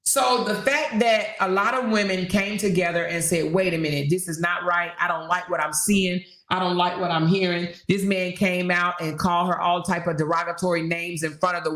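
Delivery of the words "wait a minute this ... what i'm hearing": ordinary talk, nothing marked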